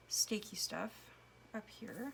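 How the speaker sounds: ordinary talk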